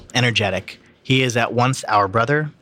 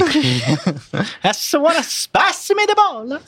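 high, drawn-out cartoon Italian accent; mock Italian accent; much slower than usual. Shown as a demonstration. Reader reprising the meatball line for laughs, exaggerated Italian accent. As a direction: stretch it slowly in hammed-up Italian. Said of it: "It's a little spicy".

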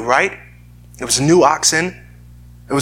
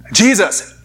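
louder and higher, calling out in alarm; a soldier calling out to Jesus in alarm; urgent call; 3 semitones higher, 3 dB louder, slower than usual. Retold or imitated. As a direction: cry out his name over the battle.

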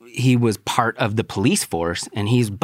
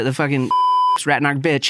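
ordinary talk, nothing marked

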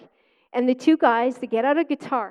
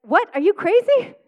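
high, loud mock disbelief; hammed-up what, are you crazy; incredulous; 7 semitones higher, 6 dB louder than usual. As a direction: pretend to be shocked at the proposal. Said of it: "you would think I'd be like".

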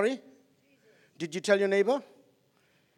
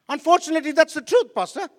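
higher, loud sermon push; preaching at full force; 6 semitones higher, 9 dB louder than usual. Acted. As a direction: press the hard truth on the church. Preaching to the congregation.

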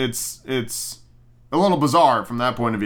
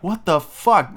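outraged; 5 semitones higher, slower than usual. Retold or imitated. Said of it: "and I'm like".

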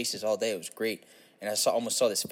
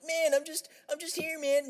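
high, helpless stoner whine; stoned hippie. Acted. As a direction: plead like a baffled stoned hippie. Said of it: "He's too stoned to know".

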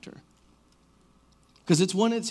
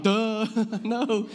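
higher-pitched duh breaking into laughter; retold laughing reply; 6 semitones higher than usual. Teacher to kid who threw a desk, laughing. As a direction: laugh through an incredulous no. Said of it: "Like, I was laughing".

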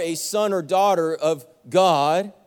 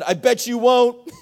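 slow, higher, louder sneer; snide taunt; 5 semitones higher, 6 dB louder than usual. Retold or imitated. Pastor to worker Michael, slow mocking taunt. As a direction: drag out the taunt.

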